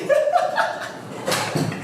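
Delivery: very high, airy laugh; amused laugh. Real, not acted. Amused by own fake yawn, high airy laughter. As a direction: laugh helplessly in a high pitch.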